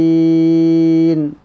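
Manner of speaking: نستعين with the ee stretched to six counts; full madd. Shown as a demonstration. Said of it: "كسر توسط مد". (نستعين بالإشباع)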